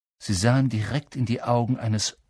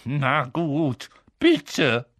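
raised, reluctant character voice; grudging surrender; 3 semitones higher, much slower than usual. A narrator's reading. Filch finally saying please to Peeves, grudging tone. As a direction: give in through irritation.

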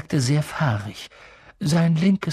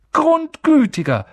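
ordinary delivery, nothing marked

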